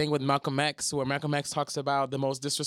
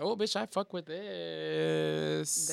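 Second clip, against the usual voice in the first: drawn-out, savoring reaction; savoring approval; 6 dB quieter, much slower than usual. Retold or imitated. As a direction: stretch the last word out slowly with relish. Acts out own approving reaction.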